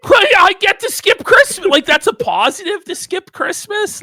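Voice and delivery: high-pitched, loud disbelief; incredulous outburst. Real, not acted. Host baffled that he would skip Christmas, incredulous shout. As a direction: burst out high in baffled protest.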